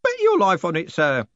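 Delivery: put-on high, cheerful old underling. A narrator's reading. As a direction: answer with cheery certainty, voice lifted. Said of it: "said Groat cheerfully".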